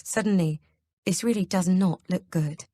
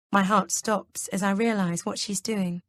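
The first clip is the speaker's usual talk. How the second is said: ordinary delivery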